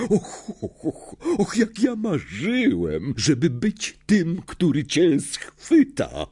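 high, slow, drawn-out relish; gloating. A narrator's reading. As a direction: savour the triumph word by word. (triumfalne, powolne napawanie się)

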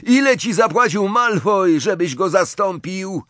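high-pitched urgent taunt; desperate yell across the pitch; desperate call. A narrator's reading. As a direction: call out to throw him off. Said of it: "zawołał w rozpaczy Harry".

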